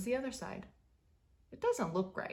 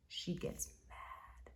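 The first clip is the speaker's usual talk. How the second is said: slow whispered warning; slow hushed menace; ominous whisper; 15 dB quieter than usual. A narrator's reading. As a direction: whisper it like trouble's coming.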